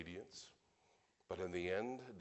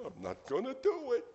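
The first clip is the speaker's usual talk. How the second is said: high, loud mock tantrum; defiant refusal; 8 dB louder than usual. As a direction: dig in your heels, voice shooting up loudly. Acting out his own refusal to God.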